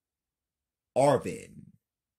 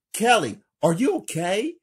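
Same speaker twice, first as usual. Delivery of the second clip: ordinary delivery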